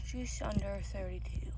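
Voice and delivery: whispered to herself; whispering. Real, not acted. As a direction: whisper the measurements. Measuring quietly to herself.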